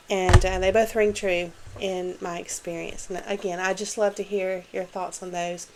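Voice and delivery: normal talking voice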